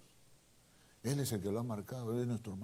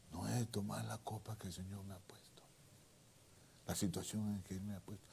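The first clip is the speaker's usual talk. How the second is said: whisper; 2 semitones lower, 10 dB quieter than usual. Real, not acted. Intimate point in the sermon.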